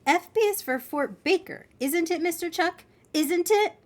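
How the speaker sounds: high-pitched pleading question; insistent child. Acted. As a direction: press the driver to confirm it. Child insistently asks for confirmation.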